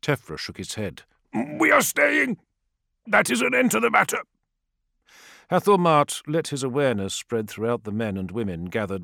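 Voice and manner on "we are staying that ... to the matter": high, stubborn elder's voice; defiant elder. A narrator's reading. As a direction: refuse firmly as the elder. Narrator voices elder Tefra.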